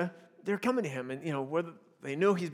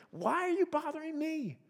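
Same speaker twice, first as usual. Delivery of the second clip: higher, softer put-upon whine; annoyed attitude; 7 semitones higher, 7 dB quieter than usual. Shown as a demonstration. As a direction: brush them off as a bother. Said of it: "Can you hear his attitude?".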